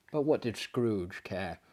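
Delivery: ordinary delivery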